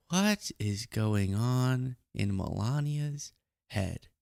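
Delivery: very slow, soft musing; hushed wondering. Acted. Host repeating the question over a muted clip, hushed and drawn out. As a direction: draw the question out softly, word by word.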